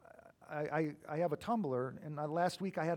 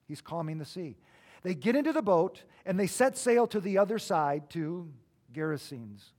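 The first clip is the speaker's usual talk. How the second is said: ordinary talk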